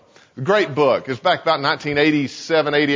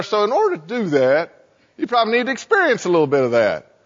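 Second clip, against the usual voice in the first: tempter Satan; 3 semitones higher than usual. Acted. Satan flattering Russ to lure him halfway, coaxing. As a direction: flatter and coax in a lifted voice. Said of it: "Satan goes here and says".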